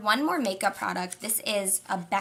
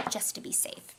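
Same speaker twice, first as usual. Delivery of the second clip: whispering; 9 dB quieter than usual. Real, not acted.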